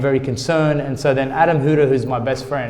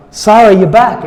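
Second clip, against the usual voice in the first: retold cry of astonishment, high and loud; loud, high-pitched disbelief; amazed cry; 7 semitones higher, 10 dB louder than usual. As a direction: exclaim it as if seeing someone lost return. Retold dream reunion with Sarah.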